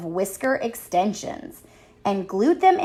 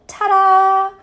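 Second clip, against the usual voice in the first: high, loud showy ta-da; proud announcement; 10 semitones higher, 8 dB louder than usual. A narrator's reading. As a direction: present yourself with a flourish. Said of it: "Harriet announced".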